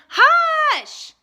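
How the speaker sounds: very high, loud shouted hush; shouting. A narrator's reading. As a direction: yell it at the schoolboy. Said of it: "they found themselves shouting".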